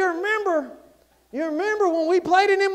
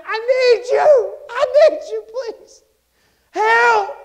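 high, loud desperate plea; crying out; 8 semitones higher, 8 dB louder, slower than usual. Acted. Child cut off from parent, crying out. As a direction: cry out for help, pitched way up. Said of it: "they are crying out and they're saying".

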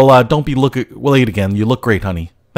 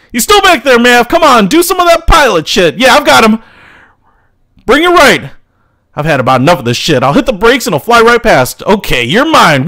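shouted dogfight calls; 10 semitones higher than usual. Acted. Pilots shouting during dogfight.

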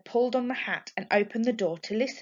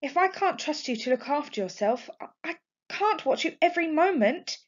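worried; 8 semitones higher than usual. A narrator's reading. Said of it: "worrying at a loose strand of hair".

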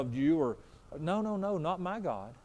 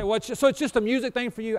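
a voiced objector, higher and louder; imagined listener objecting; 7 semitones higher, 7 dB louder than usual. Preacher voicing a listener's doubt, raised and mocking. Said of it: "You say".

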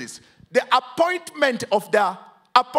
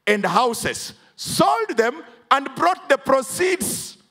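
full-force preaching; 4 semitones higher, slower than usual. Acted. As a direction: drive the verse home to the congregation.

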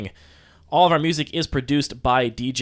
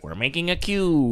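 lightly imitated, raised-pitch impression; a hint of the impression, pitched up; David Lynch; 4 semitones higher than usual. Co-host proposing a podcast intro, lightly imitated voice. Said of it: "That's like".

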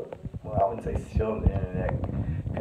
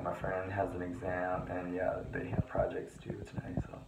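whispering; 3 semitones lower, 8 dB quieter than usual. Real, not acted. Hiding in bathroom at 3am.

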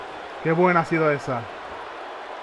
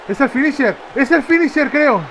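higher, excited exclamation; excited call; 7 semitones higher than usual. Real, not acted. Excited call on seeing a finisher. (exclamación emocionada)

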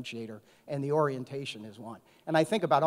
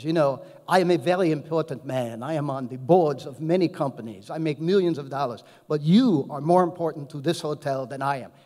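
put-on louder, proud speech in a mild French accent; 7 dB louder than usual. French CEO telling the steward he matters more, mild French accent. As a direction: imitate the French CEO making a grand point. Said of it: "so I'll try to do the accent".

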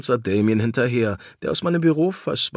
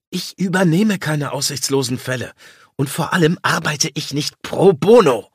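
spitting words; 4 semitones higher, 6 dB louder than usual. Acted. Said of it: "Ich spuckte Damien die Worte".

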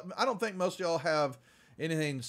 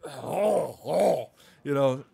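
higher, hurting plea; pained moan; 3 semitones higher than usual. Retold or imitated. Sick prisoner moans for a drink, higher. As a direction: moan like your stomach hurts. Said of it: "And he's like".

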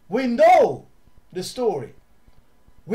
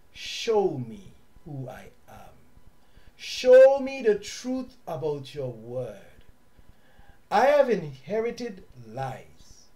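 slow, earnest prayer voice; modelled prayer; 2 semitones lower, slower than usual. Shown as a demonstration. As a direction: pray it slowly from the heart, as a sample for listeners.

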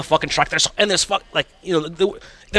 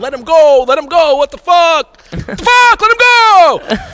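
high, loud, slow shouting; 11 semitones higher, 7 dB louder, slower than usual. Retold or imitated. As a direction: yell from a distance. Friends shouting at security.

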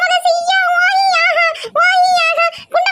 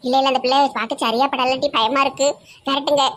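put-on much lower, grumbling old auntie; low old village woman; 3 dB quieter than usual. Old auntie frets to her sister about the pickle jars, low gruff voice.

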